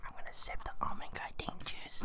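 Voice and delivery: soft ASMR whisper. Real, not acted. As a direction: whisper it into the mic. ASMR segment, whispering into mic.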